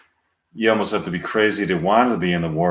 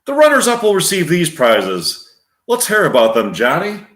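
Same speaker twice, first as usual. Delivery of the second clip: game show host, pitched up; projected quiz host delivery; Alex Trebek; 7 semitones higher, 4 dB louder than usual. Acted. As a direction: hand over to the announcer. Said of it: "Alex Trebek".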